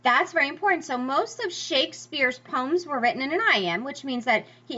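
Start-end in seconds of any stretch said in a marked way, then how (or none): none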